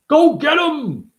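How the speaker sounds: mock rally cry; cheering. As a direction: cheer a rider on, slow and high. Cheering on horse rider.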